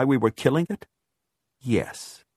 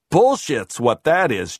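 louder, higher scornful retort; angry dismissal; 4 semitones higher, 5 dB louder than usual. A narrator's reading. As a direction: shoot the idea down hard. George rejects her murder charge.

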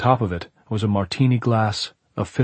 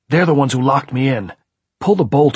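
raised, higher, insistent voice; loud urgent demand; 6 semitones higher, 8 dB louder than usual. A narrator's reading. Locked-in man orders caretaker to open up, urgently. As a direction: demand it through the door.

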